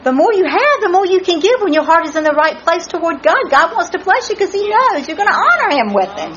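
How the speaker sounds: high, fast and rousing; excited preacher in full flow; fervent preaching. Acted. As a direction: drive the point home to a congregation. Preaches to an answering congregation.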